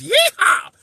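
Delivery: high whooping cowboy holler; cowboy yeehaw. Pretend. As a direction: let out a big cowboy whoop. Appreciate the hat and mustache.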